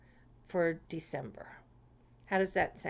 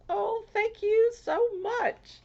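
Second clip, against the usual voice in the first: gushing thanks; 13 semitones higher, slower than usual. Real, not acted. Thanking sender of the card.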